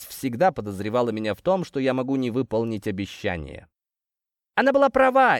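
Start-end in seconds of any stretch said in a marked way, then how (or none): none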